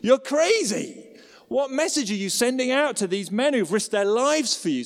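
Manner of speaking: hammed-up furious general; fuming outburst. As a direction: shout it at the king in disbelief. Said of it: "This guy is fuming".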